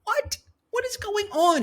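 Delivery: high squeak of disbelief; high, baffled what; shocked disbelief. Real, not acted. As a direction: react like you can't believe it. Shocked reaction to ad copy.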